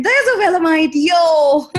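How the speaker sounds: high, loud playful cheer; excited greeting. Retold or imitated. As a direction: call out in delighted recognition. Quotes own delighted greeting on sighting someone.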